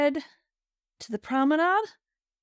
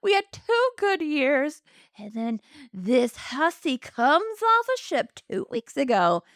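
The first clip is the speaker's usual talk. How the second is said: high-pitched melodramatic complaint; hammed-up scorned widow; 6 semitones higher than usual. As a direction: play the wronged wife, pitched way up. Widow ranting about the homewrecker.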